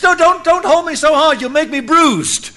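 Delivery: high, loud mock complaint; a hammed-up whine about being held too hard; whiny protest. Lot complaining to the angels who grab him, mock whine.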